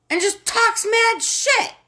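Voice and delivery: high, slow, punched-out outrage; exasperated rant. Real, not acted. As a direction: hammer each word, high and incredulous. Exasperated rant about Putin.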